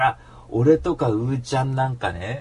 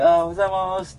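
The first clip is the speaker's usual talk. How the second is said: quick, higher-pitched polite greeting; greeting coworkers; 8 semitones higher, 4 dB louder, faster than usual. Retold or imitated. Older coworker greeting staff as he passes, quick higher greeting. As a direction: imitate a hurried morning hello. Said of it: "っていう風にこう".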